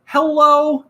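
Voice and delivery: a bright cartoon-character greeting. Fursuiter answers kids in a minivan with a high loud hello. Waves back as Charlie.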